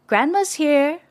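shouting. A narrator's reading. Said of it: "shouted his mom".

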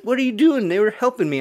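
loud, high imitation of an upset coworker; mock indignant protest. Coworker after her call was hung up, mock indignant outcry.

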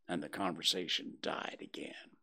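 ordinary delivery

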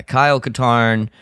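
normal talking voice

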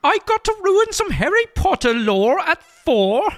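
high-pitched put-on British author; J K Rowling. Rowling answers her exasperated agent, high British voice. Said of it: "I will be J K Rowling".

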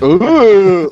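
high, loud drawn-out "ooh"; long rising taunting "oooh"; crowd "ooh". Retold or imitated. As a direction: imitate an audience reacting to a burn. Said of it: "And everybody's like".

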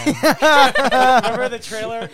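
loud, slow laugh-talking. Real, not acted. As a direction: blurt it out laughing. Laughing loudly mid-story.

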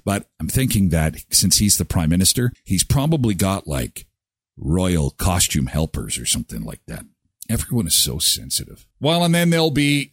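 usual speaking voice

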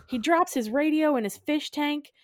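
ordinary talk

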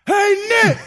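high, loud yell from far off; hollering down the field to the coach; calling across the field. Retold or imitated. Lineman walking the forty yells to Nick Saban, a loud high call.

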